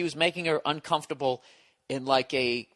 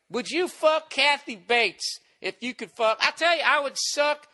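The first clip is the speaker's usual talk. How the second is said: put-on high drunken rambling, stretched words; mocked drunk guy; 11 semitones higher than usual. Drunk guy playing his crude game, mocking imitation. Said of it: "He was playing that game".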